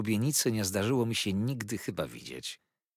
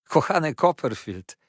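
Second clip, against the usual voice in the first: higher, louder, moved greeting; emotional exclamation; 6 semitones higher, 6 dB louder than usual. A narrator's reading. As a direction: cry out to a dear friend. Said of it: "wołał Treadles we wzruszeniu".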